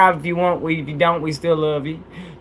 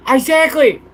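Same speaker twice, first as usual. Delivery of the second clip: emphatic high; 10 semitones higher, 4 dB louder than usual. Real, not acted. Emphatic agreement.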